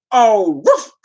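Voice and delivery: comic spoken bark, loud; dog bark. Pretend. Streamer imagining wearing the collar, dog bark. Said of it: "it just come out as dog barks".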